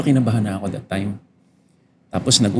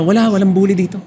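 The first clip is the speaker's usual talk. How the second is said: dismissive denial; 6 semitones higher, faster than usual. Retold or imitated. Father mimicking the other parents denying bullying, mocking and dismissive. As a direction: mimic the parents brushing it all off.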